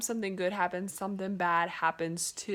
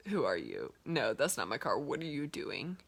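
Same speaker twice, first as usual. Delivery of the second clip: suspicious stranger; 8 semitones lower, 3 dB quieter than usual. Retold or imitated. Suspicious preacher voiced.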